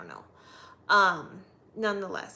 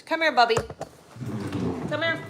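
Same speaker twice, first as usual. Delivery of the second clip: loud, high call to the dog; 3 semitones higher, 10 dB louder, faster than usual. Real, not acted. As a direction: call the puppy over. Calling the dog.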